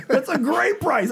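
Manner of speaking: high, slow, delighted; giddy delight. Retold or imitated. Telling Gary the price is great, high and giddy. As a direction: savor the bargain with a high laugh. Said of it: "And I was like".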